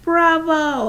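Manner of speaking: loud, high cheering bravo; dad cheering from the audience; yelled bravo. A narrator's reading. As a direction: call it out over the applause. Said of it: "I heard Dad yelling".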